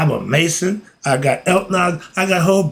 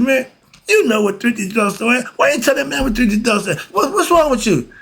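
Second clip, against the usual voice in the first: incredulous scolding; 8 semitones higher, faster than usual. Acted. Boy scolds friend in joke.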